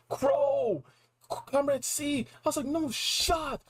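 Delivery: stunned and thrilled disbelief; shocked realization. Retold or imitated. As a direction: yell the name like it all clicks.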